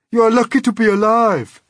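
loud, high rebuke; scolding. A narrator's reading. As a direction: tell the whiner off forcefully. Earthworm scolds the whining centipede.

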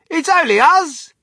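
high, loud announcement; calling out reassurance. A narrator's reading. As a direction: call it over the commotion. Calls out to panicking wife.

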